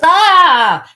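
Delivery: loud, high cry of pain; sudden mock scream. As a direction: scream as if your thumb just tore off. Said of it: "makes this noise, and then he goes".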